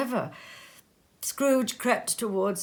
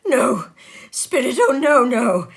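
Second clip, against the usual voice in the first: loud, higher, frantic denials; desperate pleading; 4 semitones higher, 11 dB louder than usual. Acted. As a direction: beg the ghost not to let it be true. Scrooge begs the phantom.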